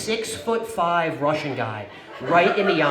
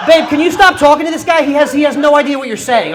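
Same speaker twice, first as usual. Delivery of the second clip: high, loud exasperated outburst; fed up and raising your voice; exasperated plea; 9 semitones higher, 10 dB louder than usual. Retold or imitated. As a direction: plead loudly with a partner to stop. Acts out fed-up plea to girlfriend.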